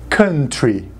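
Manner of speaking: country said kuhn-tree, slow and clear; model for repetition. Shown as a demonstration. Teacher leading the class in repeating, clear model. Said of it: "Repitam comigo".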